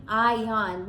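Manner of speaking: usual speaking voice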